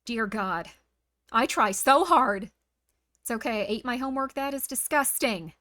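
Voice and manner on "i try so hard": slow, loud, hammed-up lament to the heavens; melodramatic wounded mother. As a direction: overplay the martyred mom, drawing out so. Mock mother sighs at chat.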